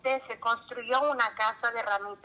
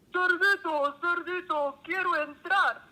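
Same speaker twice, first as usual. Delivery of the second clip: hoarse wolf; 6 semitones higher than usual. A narrator's reading. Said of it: "Con una voz muy ronca".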